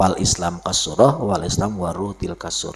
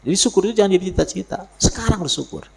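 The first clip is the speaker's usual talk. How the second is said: fast urgent; 11 semitones higher, much faster than usual. Real, not acted.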